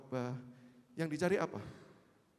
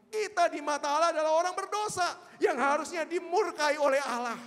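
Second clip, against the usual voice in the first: high, loud pulpit preaching; full force preaching; 10 semitones higher, 8 dB louder than usual. Acted. Preacher urging the congregation to see through grace, loud fervent preaching. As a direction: drive grace home to the congregation.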